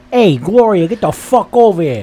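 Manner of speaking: loud, high Italian-American holler; mock a bad Italian-American yell; Italian-American accent. Host mockingly echoes co-host's yell at Gloria in an Italian-American accent. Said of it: "Was that supposed to be Italian?".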